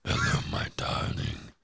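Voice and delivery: slow, soft croaked endearment; put-on sick morning greeting; mock morning voice. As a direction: rasp it out slowly, barely voiced. Playing the deep morning voice.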